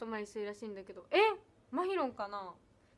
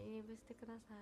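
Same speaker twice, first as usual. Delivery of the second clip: 「入部してください」 said again much softer; soft repeat; 5 semitones lower, 20 dB quieter than usual. Real, not acted. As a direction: drop 「入部してください」 to a near-murmur. Invites fans, then repeats softly. (「入部してください」を小声でつぶやく)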